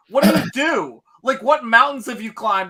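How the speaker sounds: a hint of a scornful hero sizing up a rival; mock scornful challenge.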